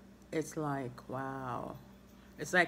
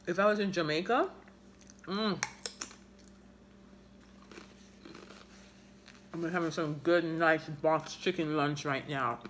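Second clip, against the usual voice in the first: speaking behind hand; talking while chewing; faster than usual. Real, not acted. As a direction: mouth full of food. Seen 2: puts food in mouth, chews, covers mouth while talking.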